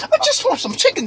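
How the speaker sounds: high, tearful whine; mock crying. Customer begs upset cashier for chicken, mock crying. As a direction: plead in a squeaky, about-to-cry voice. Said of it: "I started crying".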